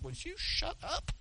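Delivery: high, hushed, slow mock plea; soft, high-pitched imitation of an annoyed onlooker; exasperated aside. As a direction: shush someone under your breath in a squeaky voice. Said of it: "everybody's looking at him, he's like".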